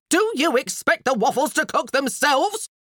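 high, loud scolding; demanding. A narrator's reading. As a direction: demand it from the window. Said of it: "came the demand from the window".

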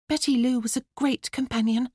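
put-on high girlish solemn address; best funeral voice. A narrator's reading. Daughter opening a plant's funeral, funeral voice. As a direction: intone it like a child officiant. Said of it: "in her best funeral voice".

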